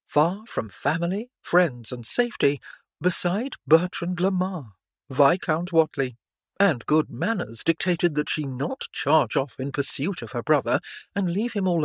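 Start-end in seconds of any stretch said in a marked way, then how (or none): none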